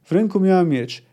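plain reading voice

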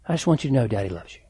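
a lightly hushed father's promise; tender reassurance to daughter. Retold or imitated. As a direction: reassure her quickly and fondly. Father tells other daughter he loves her.

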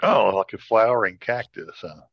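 normal talking voice

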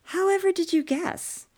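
high, arch mock surprise; a sister mocking her brother's slowness; sarcastic sister.

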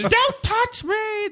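very high, drawn-out mock shriek; mock scream. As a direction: imitate an overwrought movie scream. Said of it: "She screams the whole time".

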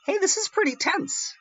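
high, put-on viewer's voice; mildly impressed viewer. Modern viewer reacting to an old horror film, mildly impressed. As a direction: pitch it way up, as a casual reaction. Voicing a viewer watching it now.

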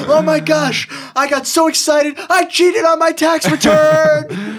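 very high, loud squeal, slow end; giddy over-the-top excuse; mock excited voice. Mocking an excited criminal.

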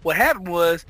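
usual speaking voice

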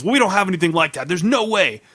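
mock outraged denial; indignant denial. Manager denies glass in bread, mock indignant.

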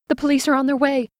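high, loud and fast; Henry announcing the police are coming, fast and high; urgent report. A narrator's reading. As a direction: rush the news out. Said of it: "Henry said".

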